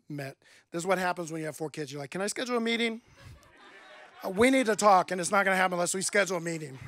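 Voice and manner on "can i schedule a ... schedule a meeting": half-joking exasperated request, louder; mock exasperated parent. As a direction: play the tired parent begging for time. Parent of four asking spouse.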